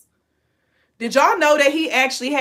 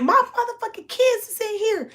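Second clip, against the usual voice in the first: much higher, frantic mother's cry; imitating the mother; 13 semitones higher than usual. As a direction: voice the mom protecting her kids. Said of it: "she was like".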